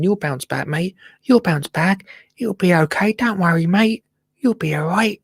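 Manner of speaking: high, soothing pep talk to a sick shrub; cooing at a plant. Pretend. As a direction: reassure the plant like a sad little mate. Comforting a yellowing plant.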